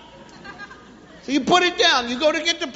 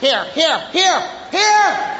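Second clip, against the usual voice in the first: barrage of calls; 2 semitones higher, slower than usual. Acted. Parent calling a child who doesn't hear, escalating barrage. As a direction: snap them out, climbing each time. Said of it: "send a barrage of hears".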